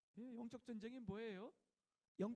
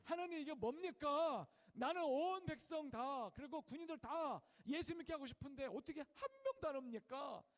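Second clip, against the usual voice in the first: angry lament; 4 semitones higher, 5 dB louder than usual. Retold or imitated. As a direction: plead with God, aggrieved and loud. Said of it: "화가 나가지고 몇 시간을 기도하면서 울었대요".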